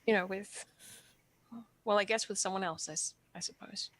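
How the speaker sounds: halting, on the verge of tears; hesitant confession with long, emotional pauses; tearful, holding back. Acted. Asking for a blessing to have a family, tearful. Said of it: "I was really tearing up".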